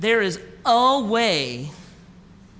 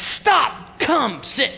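calling out sharply; 5 semitones higher, 3 dB louder, much slower than usual. Retold or imitated. Calling a passing son over.

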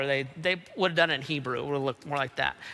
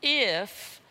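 "if" repeated alone, stretched, before a pause; isolated, emphatic "if"; stress on if; 2 semitones higher than usual. Shown as a demonstration. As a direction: land on "if" and let it hang. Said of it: "it's a big if".

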